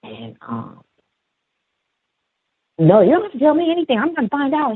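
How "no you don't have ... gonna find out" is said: fast, very high mock retort; insistent assistant. Assistant brushing off the boy's explanation, insistent high voice. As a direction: mimic her cutting in, quick and squeaky.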